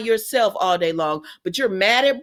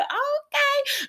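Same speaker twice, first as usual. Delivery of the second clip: high, slow drawn-out "Okay", much higher; mock giddy okay; 4 dB quieter than usual. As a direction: swoon out the okay like a lovesick fool. [name] mocking her own giving in.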